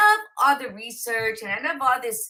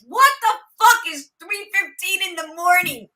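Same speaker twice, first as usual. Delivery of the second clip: higher, louder startled outburst at the clock; as if she just saw the late hour; shocked; 7 semitones higher, 4 dB louder than usual. Retold or imitated. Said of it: "I look up and I'm like".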